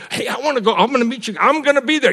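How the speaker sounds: high, keen would-be joiner; eager promise. Retold or imitated. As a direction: imitate someone insisting they'll come. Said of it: "they'd, you know".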